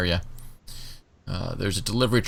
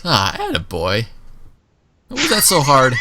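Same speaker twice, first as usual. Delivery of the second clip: slow praise rising to a louder tease; patronising guard; 6 semitones higher, 5 dB louder than usual. Acted. Guard praising Johnson after the push-ups, teasing. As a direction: pat him on the head with words. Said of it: "She looks at you and she goes".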